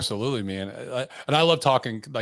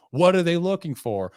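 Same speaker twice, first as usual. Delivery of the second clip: usual speaking voice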